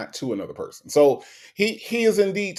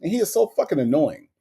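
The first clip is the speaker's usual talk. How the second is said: ordinary talk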